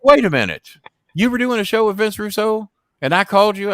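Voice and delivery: mock shocked Jimmy. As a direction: play the friend catching on. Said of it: "Jimmy realizing".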